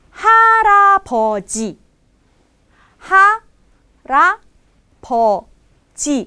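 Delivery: harapoji drawn out, starting high; slow model word. Shown as a demonstration. Teacher modelling harapoji for students, slow and high. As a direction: harapoji said slowly with a clear r. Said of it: "Bukannya halapoji, tapi harapoji".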